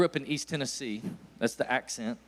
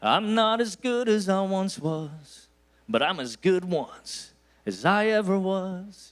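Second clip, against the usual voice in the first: slow, drawn-out imitation of the singer, a bit higher; lightly crooned country lyric; quoting the song lyric; 4 semitones higher, 3 dB louder, slower than usual. Preacher quoting the song to the crowd, slow imitation. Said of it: "the guy who wrote the song".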